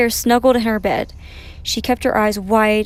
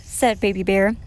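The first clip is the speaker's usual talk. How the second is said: usual speaking voice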